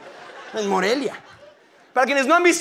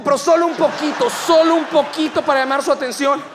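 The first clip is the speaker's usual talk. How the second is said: usual speaking voice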